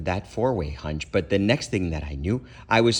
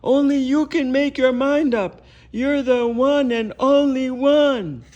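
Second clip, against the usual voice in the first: high, loud, slow rallying yell; 7 dB louder than usual. Acted. Super hunch yells at the boy to decide. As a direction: bellow it like a cheering coach. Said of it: "some super hunch was yelling".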